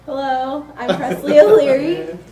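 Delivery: laughing through a shy hello; nervous laugh. Real, not acted. Student at the council podium introducing herself, laughing nervously. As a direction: giggle while saying your name.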